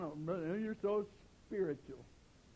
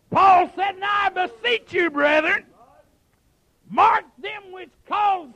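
raised, forceful pulpit cry; full-force preaching; 11 semitones higher, 15 dB louder, slower than usual. Acted. Preacher quoting Paul to the brethren, full-force preaching. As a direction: punch out the scripture with drawn-out words.

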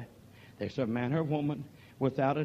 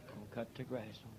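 Gonna cut the grass on nearly whispered, much softer and lower; hushed echo; 4 semitones lower, 18 dB quieter than usual. Real, not acted. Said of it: "shouted just before".

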